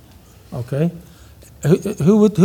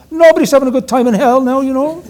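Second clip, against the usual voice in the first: high, louder imitation Cork accent; 8 semitones higher, 6 dB louder than usual. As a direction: press the point in a high mock Cork accent. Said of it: "almost indecipherable Cork accent".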